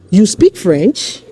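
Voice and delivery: "You speak French?" with FRENCH rising at the end; statement turned question, lifting on "French"; question intonation. Shown as a demonstration. Teacher showing learners how to ask by intonation alone, question intonation. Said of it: "intonating that statement as a question".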